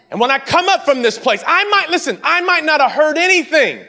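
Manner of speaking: high, loud, shouted build of faith; fiery rising sermon; preaching climax. Acted. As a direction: preach it up to a roar. Preaching climax at full force.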